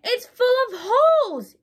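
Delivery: high, loud shocked cry; a wife discovering her ruined dress; horrified exclamation. A narrator's reading. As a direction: wail it in dismay. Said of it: "exclaimed Missus Rogers".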